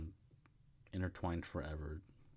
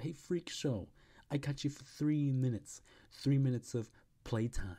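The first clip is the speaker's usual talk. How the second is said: higher, louder mock wrestler taunt; 7 semitones higher, 4 dB louder than usual. Wrestler taunting Peter before the cage match, higher and louder. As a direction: sneer it at the freak in the cage.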